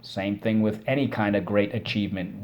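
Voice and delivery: ordinary talk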